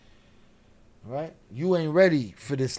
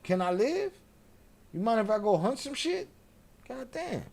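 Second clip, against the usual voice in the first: higher, needy-hating cat voice; annoyed cat; 7 semitones higher than usual. Acted. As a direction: whine it as a cat fed up with cuddles. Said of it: "the cat's like".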